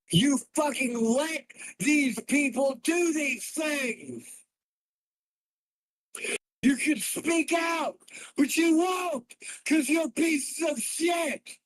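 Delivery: high, slow, screamed accusations; furious shout. Real, not acted. Streamer screaming at his chat, furious shout. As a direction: yell each word at them in rage.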